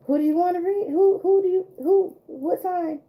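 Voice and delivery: squeaky, high and playful; high sing-song call. Real, not acted. Tarot reader playfully asks her live chat whose sign, high squeak.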